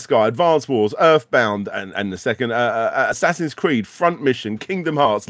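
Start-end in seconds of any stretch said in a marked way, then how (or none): none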